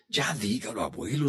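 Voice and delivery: rogando en voz baja, impaciente; un niño suplica al abuelo, bajito; súplica suave. A narrator's reading. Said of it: "suplicó Toño". (suplicando en voz baja)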